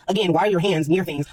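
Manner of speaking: ordinary talk, nothing marked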